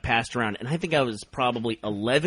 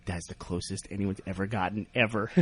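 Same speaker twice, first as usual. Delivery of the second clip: lower, softer mock-amazed; awed hush; 8 semitones lower, 11 dB quieter than usual. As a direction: sell the fake record quietly. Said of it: "He goes".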